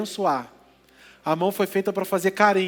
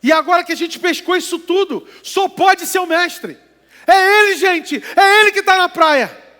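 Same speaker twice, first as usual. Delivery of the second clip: rising, louder and higher as it dawns on him; excited realization; 6 semitones higher, 5 dB louder than usual. Acted. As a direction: build from awe to an excited cry of recognition. Peter realizes it is Jesus.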